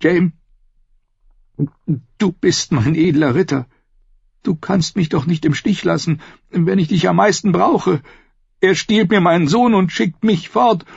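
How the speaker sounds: high woman's voice, pleading near tears; fighting back tears. A narrator's reading. Sister begs her noble knight not to abandon her, tearful. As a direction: hold back the tears as you beg. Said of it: "kämpfte mit den Tränen".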